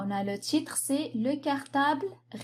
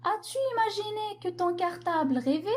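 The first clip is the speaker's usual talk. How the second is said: higher, quicker questioning read; poet questioning a child; 7 semitones higher, faster than usual. Acted. The poet asks a child on holiday, higher questioning voice. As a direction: put it to the child as a lifted question. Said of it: "Qu'est-ce qu'il va dire à cet enfant?".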